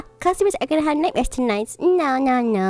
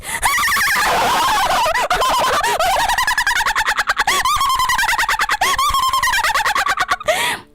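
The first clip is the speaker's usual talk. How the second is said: very high, long mocking cackle; group cackle. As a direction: cackle at Cinderella. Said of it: "All of them laughed".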